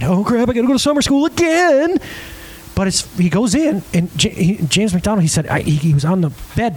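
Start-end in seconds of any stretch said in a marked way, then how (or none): 0.0-2.0 higher-pitched kid's dread, acted; mock dismay rising through the line; afraid kid. Kid gets summer school notice, mock worried high whine. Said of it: "what kid wouldn't be afraid?".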